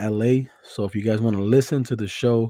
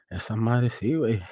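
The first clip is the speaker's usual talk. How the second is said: ordinary delivery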